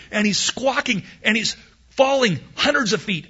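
high, loud, rushing storytelling; preacher acting out the falling eaglet, frantic; frantic excitement. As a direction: build the panic of the falling eaglet. Excited telling of the eaglet's fall.

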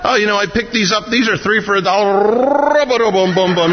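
high chatty shopper breaking into mock tongues; lightly voiced cashier chatter, then nonsense babble; mock checkout lady. Pastor mimics a shopper at checkout, high and babbling. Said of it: "imagine a lady at the checkout stand".

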